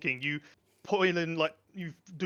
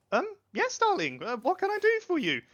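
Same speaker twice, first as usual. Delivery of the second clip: much higher, airy grand-lady voice; haughty lady; 13 semitones higher than usual. Acted. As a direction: condescend sweetly from above. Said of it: "as she looks down and goes".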